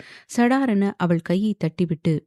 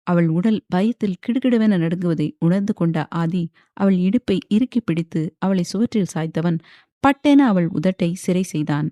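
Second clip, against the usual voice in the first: plain reading voice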